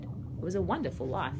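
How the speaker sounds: plain reading voice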